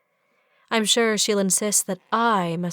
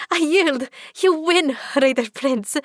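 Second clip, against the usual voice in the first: higher, louder breathless surrender; louder laughing cry; 7 semitones higher, 5 dB louder than usual. Acted. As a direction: shriek it out laughing. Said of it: "crying out".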